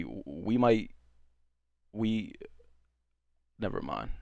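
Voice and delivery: halting, stuttering start. Real, not acted. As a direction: trip over the words. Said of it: "I'm stuttering".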